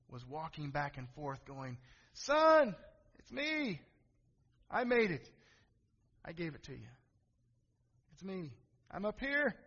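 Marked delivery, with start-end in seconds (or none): 2.1-9.6 pleading, calling up high to his son; a father straining to be seen; desperate calling father. Retold or imitated.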